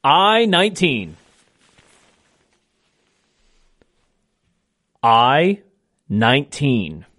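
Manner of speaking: slow, deliberate number calling; bingo caller. Acted. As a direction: call each ball slowly with falling ends. Calls each bingo ball twice.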